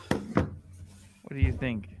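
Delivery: low, slow and leering; slow creepy voice. Acted. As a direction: drawl it out low and suggestive. Slow creepy question to chat.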